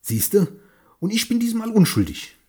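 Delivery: put-on high, loud, triumphant old man; smug husband voice. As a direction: puff up and crow at the wife. Husband boasts to his wife.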